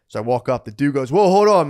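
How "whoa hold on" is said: loud, high "whoa, hold on"; loud interruption. Retold or imitated. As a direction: cut him off loudly. Guy stops him loudly.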